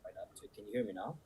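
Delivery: faint and distant from the microphone; speaking away from the mic; far from mic. Real, not acted. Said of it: "you were loud at first".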